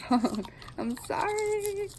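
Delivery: very high, slow sing-song. Real, not acted. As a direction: coo an apology to the pet. Apologizing to dog, very high.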